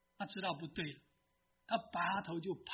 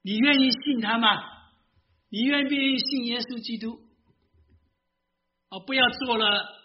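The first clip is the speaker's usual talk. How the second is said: louder, urgent appeal to believe; preaching altar call; 5 semitones higher, 9 dB louder than usual. Acted. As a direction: press the listeners to believe. Altar call, urging listeners. (大声呼召听众信主)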